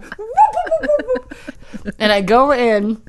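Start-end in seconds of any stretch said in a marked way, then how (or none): 0.0-1.9 very high whoops through a laugh; fast forward sound, laughing. Shown as a demonstration. Repeating her fast-forward noise, higher, with a laugh. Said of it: "that's my fast forward sound".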